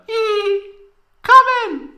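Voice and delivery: very high, loud falsetto invite; high falsetto Tifa. Acted. Tifa inviting Cloud in, loud falsetto. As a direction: call out in a squeaky girl voice.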